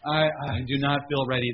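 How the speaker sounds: loud, high pleading; desperate prayer. Retold or imitated. Pastor unprepared for Sunday, loud pleading prayer. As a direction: beg God as if you have nothing left. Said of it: "I'm crying out to the Lord more".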